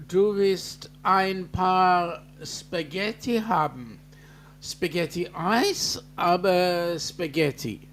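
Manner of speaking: slow, careful mock-German phrases; a deliberate, halting German greeting; German accent. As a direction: spell out the German slowly like a tourist. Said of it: "how was that for my German?".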